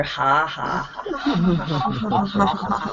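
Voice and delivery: soft low ha ha, fading away; gentle giggle. Shown as a demonstration. Teacher showing warm-up laughter: gentle fading giggle. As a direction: let a gentle laugh roll down on the exhale. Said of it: "let out a gentle giggle".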